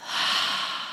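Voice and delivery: quiet breathy release through the mouth; soft exhale sigh. Shown as a demonstration. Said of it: "Exhale through the mouth".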